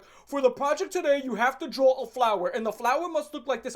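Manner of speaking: hammed-up schoolteacher laying down rules; imitating a fussy teacher; mock teacher voice. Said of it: "It's always like".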